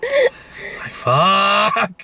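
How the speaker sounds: high cracking mock sob; mock crying. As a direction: whimper it like a fake cry. Venting about carrying baggage.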